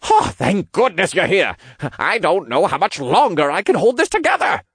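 put-on high, strained old man's voice; relieved old cultist. A narrator's reading. Old cultist holding up the building, relieved and desperate. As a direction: cry out in relief while straining to hold on. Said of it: "the old cultist called out with relief".

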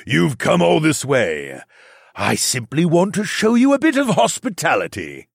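mock-polite giant demon, booming; booming demon lord. Demon King speaks on.

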